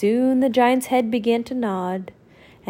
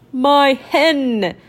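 dim giant cyclops; 4 semitones higher, 4 dB louder than usual. Acted. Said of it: "cried the giant".

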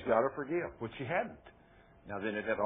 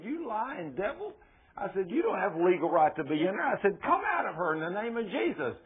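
raised-pitch command, mildly forceful; acted rebuke; 7 semitones higher than usual. Preacher commanding a demon out of a woman, acted rebuke. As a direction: order it out with a touch of fire.

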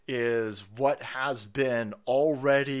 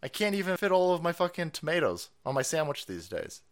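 ordinary talk

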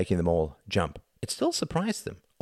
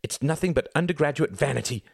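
high-pitched dismissal; cried out; 9 semitones higher than usual. A narrator's reading. Ruth dismissing Reginald's argument, crying out. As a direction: cry it out in scorn, pitched high. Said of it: "cried Ruth".

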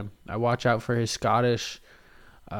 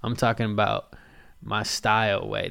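ordinary delivery

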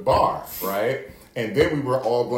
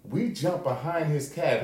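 ordinary talk

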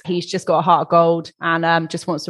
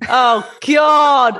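loud, higher-pitched dismay; exasperated groan; 5 semitones higher, 6 dB louder than usual. Real, not acted. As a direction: cry out at the very idea. Dismayed by Valentine's Day reminder.

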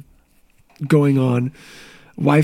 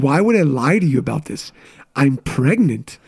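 normal talking voice

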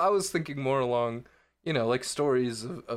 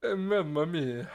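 slow, higher fake Italian accent; mock Italian accent; 5 semitones higher, slower than usual. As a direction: echo it in a put-on Italian accent. Co-host echoes the fake Italian.

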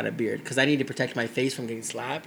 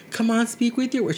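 put-on mother coaxing child; 8 semitones higher than usual. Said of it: "And then she'll be".

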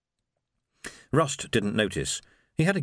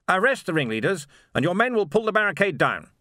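high, louder officer giving orders; pompous officer; 9 semitones higher, 4 dB louder than usual. A narrator's reading. Captain Rust commanding Vimes, high and loud.

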